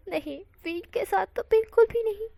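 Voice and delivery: mock horror, shaking it off; flustered refusal. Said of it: "वांग जी कहता है".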